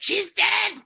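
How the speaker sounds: high, loud mock outburst; mock shout. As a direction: bellow it like an outraged patriarch. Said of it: "he would have said".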